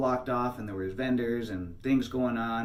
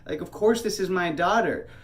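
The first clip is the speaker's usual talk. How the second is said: raised, high-pitched indignant comeback; heated retold protest, pitched up; angry retort; 8 semitones higher, 5 dB louder than usual. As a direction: tell someone off in loud disbelief. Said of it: "starting to get angry".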